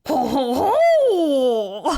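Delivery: mock werewolf howl; French werewolf howl. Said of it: "Do, do a French werewolf howl".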